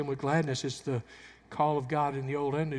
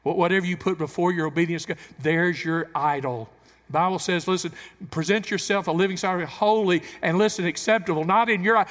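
preaching at full force; 2 semitones higher, 8 dB louder than usual. Acted. Preacher pressing the congregation, loud and climbing. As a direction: drive it home to the church.

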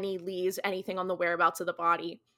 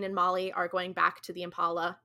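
normal talking voice

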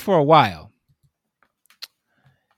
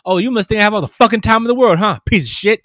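higher, louder, fast furious rant; angry customer; 7 semitones higher, 6 dB louder, faster than usual. Retold or imitated. Customer cursing out the store clerk, furious and fast. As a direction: spit it out like an enraged customer.

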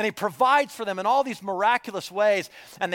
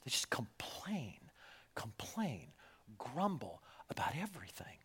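soft, much quieter whispered grumble; grumbling whisper; 6 semitones lower, 18 dB quieter than usual. Acted. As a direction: mutter it like a sulking complainer. Mimics grumbling in hush.

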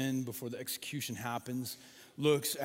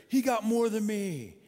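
put-on whiny kid; 6 semitones higher, 6 dB louder than usual. Kids complaining about fairness.

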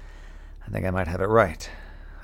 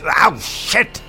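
loud, higher yelp of pain; pained shout; 10 semitones higher, 5 dB louder than usual. Acted. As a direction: shout it as the hand gets hurt. Hurts herself on the pod.

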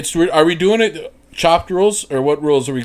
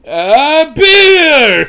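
high, loud announcer cry; mock announcer; 10 semitones higher, 8 dB louder than usual. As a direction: call it out like a host. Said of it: "he was just like".

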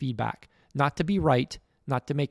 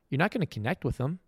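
ordinary talk

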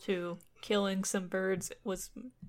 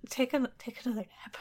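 fast whispered advice; 3 semitones higher, faster than usual. Acted. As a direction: whisper it quickly. Fairy advises hero in story.

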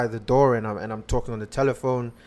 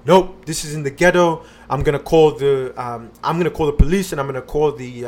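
retold threatening lady; 2 semitones higher, 8 dB louder than usual. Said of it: "she comes out".

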